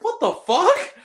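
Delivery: can't believe what he saw; incredulous. Real, not acted. Reacting to the Santa scene.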